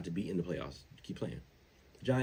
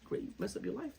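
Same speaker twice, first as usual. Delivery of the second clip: high, softer mock friend voice; taunting friend; 7 dB quieter than usual. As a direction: imitate a street friend, pitched way up. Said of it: "friends in the street like".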